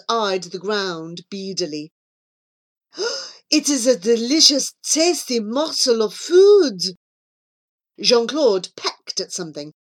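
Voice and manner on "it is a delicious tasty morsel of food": put-on gasp, then loud French-accented relish; thrilled. Jean-Claude spotting food on the ground, thrilled. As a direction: savour it with delighted greed.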